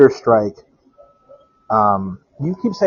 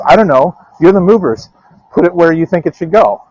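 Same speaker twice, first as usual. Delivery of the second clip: grandmother's reply; 6 semitones higher, 6 dB louder than usual. Retold or imitated. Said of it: "grandmother retold".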